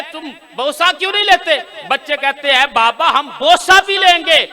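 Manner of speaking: very high, very loud, slowing at the end; preaching at full force. Acted. Preacher crying out the children's wonder to the gathering, full force. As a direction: build to a shouted climax. Climax, children's astonished reply.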